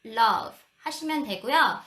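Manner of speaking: usual speaking voice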